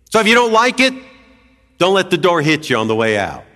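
hammed-up careless professor, loud; dismissive brush-off. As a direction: play the uncommitted boss shrugging you off. Mock brush-off, imagined uncaring Jesus.

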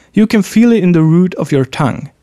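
ordinary delivery

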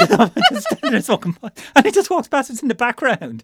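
high, loud, laughing exclamation; laughing disbelief. Real, not acted. Recalling an absurd film scene to a friend, laughing in disbelief. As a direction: crack up while exclaiming in disbelief.

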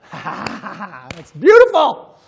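laughing through the words, drawn out; real delighted laugh. Real, not acted. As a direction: let a loud laugh spill into speech. Laughs at what he read.

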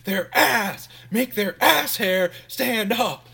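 loud, high, drawn-out mock command; fiery step leader. Step leader firing up sorority girls, mock fiery command. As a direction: imitate a drill-style motivator, punching it slowly.

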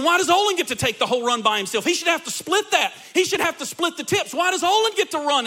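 high, loud mock outrage; mad jealous drivers. Pastor voicing drivers mad about the big order, mock outrage. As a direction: protest like cheated coworkers. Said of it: "all the other drivers are now mad".

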